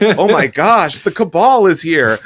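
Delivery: mock-panicked outburst about the cabal. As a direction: play the company freaking out, half joking. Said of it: "And they're freaking out".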